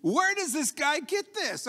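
high-pitched, baffled disbelief; incredulous. Acted. Preacher reacting to David's slingshot boast, incredulous. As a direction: question it as if it's absurd.

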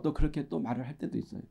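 usual speaking voice

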